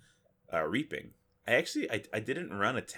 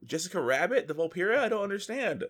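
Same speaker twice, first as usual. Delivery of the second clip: higher, incredulous questioning; retold disbelief; 8 semitones higher than usual. Reacting to a Volpira's plain voice, higher disbelief. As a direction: act baffled at what you just heard. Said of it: "and I was like".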